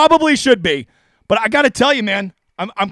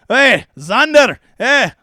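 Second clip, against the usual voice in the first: put-on stretched-out, botched Scottish accent; mock Scottish accent; much slower than usual. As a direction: put on a dubious Scottish brogue. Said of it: "I don't know why he sounds Scottish".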